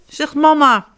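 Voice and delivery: ordinary delivery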